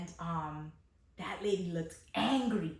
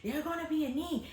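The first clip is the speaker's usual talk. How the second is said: angry mother; 9 semitones higher than usual. Retold or imitated. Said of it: "She was like".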